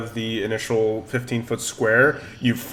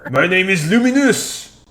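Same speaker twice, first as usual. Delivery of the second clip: put-on higher French-accented introduction; french chef; 7 semitones higher than usual. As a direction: announce the name in a French accent. Candelabra introduces itself.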